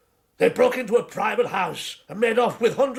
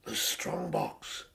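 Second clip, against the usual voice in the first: hushed, slow, breathless whisper of alarm; startled whisper; 12 dB quieter, much slower than usual. Acted. Man alarmed that burglars know of his strong box, whispering. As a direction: breathe it out, caught off guard.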